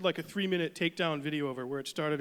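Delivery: ordinary talk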